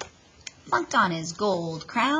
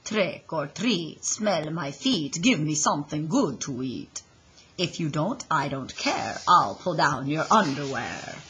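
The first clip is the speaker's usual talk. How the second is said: put-on a kid hamming up a spooky count; Dracula voice; 2 semitones lower than usual. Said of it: "in his best Dracula voice".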